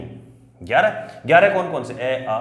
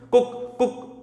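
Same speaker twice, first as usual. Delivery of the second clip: short high kuk, barely voiced; tiny clipped kuk like a distant rooster; short, barely voiced rooster imitation; soft short rooster call; 9 semitones higher, much slower than usual. Shown as a demonstration. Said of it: "छोटा सा, बहुत धीरे से आवाज आती".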